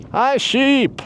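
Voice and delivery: put-on a failed Irish accent that comes out Scottish, high and loud; Irish accent gone Scottish. Hiker retries an Irishman's greeting to sheep, in a Scottish-sounding accent. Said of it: "Yeah, Scottish".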